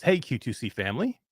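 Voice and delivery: usual speaking voice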